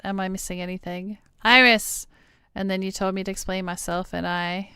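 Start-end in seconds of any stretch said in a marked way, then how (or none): 1.4-2.1 calling out. Acted. Calling a character by name.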